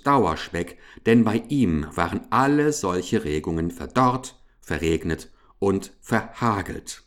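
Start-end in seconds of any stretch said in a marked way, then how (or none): none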